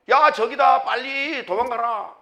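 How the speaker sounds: high, loud warning call; watchman's shout. Acted. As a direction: cry out the alarm, stretching the urgent command. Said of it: "소리치잖아요". (파수꾼처럼 크게 외치기)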